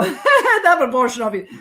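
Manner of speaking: chuckling at your own confession; laughing repeat. Real, not acted.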